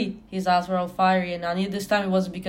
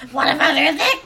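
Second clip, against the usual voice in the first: higher, louder hammed-up snap; mock angry; 7 semitones higher, 6 dB louder, faster than usual. Reading a fanfic line aloud to friends with mock anger. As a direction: overact the anger of a bad fanfic. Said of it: "I asked angrily".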